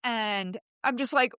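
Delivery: ordinary talk, nothing marked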